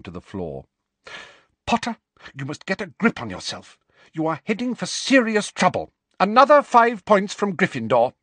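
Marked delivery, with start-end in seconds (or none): 1.7-8.1 higher, louder scolding; strict teacher punishing a pupil; stern reprimand. A narrator's reading. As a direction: lay down the law. McGonagall reprimands Harry.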